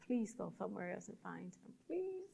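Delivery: very soft, under-the-breath begging; hushed pleading. Retold or imitated. Uncomfortable worker begging patient to leave, hushed plea. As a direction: beg softly, barely voiced.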